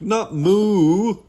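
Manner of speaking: "moo" long, high and loud; loud, stretched emphasis on "moo"; stress on moo. Acted. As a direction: drag out "moo" to correct the mishearing. Frank corrects misheard word.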